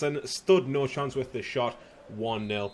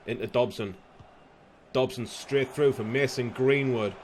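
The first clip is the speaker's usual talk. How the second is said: ordinary talk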